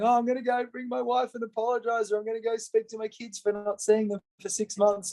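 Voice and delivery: high, earnest retold resolutions; moved audience member. Audience members at his talk vowing to apologise, earnest. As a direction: voice someone newly resolved to make amends.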